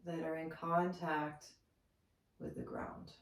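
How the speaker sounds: soft meditation guide with long pauses; softly spoken, spacious relaxation voice; guided meditation. Acted. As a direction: guide them gently into stillness, unhurried.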